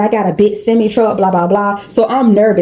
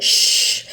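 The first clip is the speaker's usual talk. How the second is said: long unvoiced "shh"; soft-edged, sustained hushing sound; hushing shush; 4 dB quieter than usual. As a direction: hush someone with a drawn-out shush. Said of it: "and she said".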